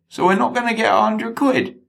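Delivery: a dim sidekick who can't believe it; incredulous accomplice. Acted. Accomplice realises no money.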